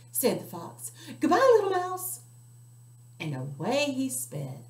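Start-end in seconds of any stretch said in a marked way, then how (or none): 1.2-2.2 high, loud nervous farewell; hasty goodbye. Acted. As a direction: get out of there fast. Fox flees.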